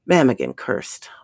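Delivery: usual speaking voice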